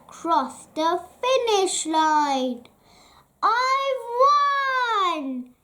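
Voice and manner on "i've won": high, bright cry of triumph; excited cry. A narrator's reading. Mister Rush crying out after crossing the line, thrilled and high. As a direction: crow it out like a winner. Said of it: "cried Mister Rush".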